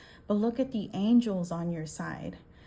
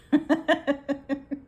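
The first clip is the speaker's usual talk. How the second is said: high, louder burst of laughter; laughing; 6 semitones higher, 5 dB louder than usual. Real, not acted. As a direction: crack up after the joke. Amused by own quote.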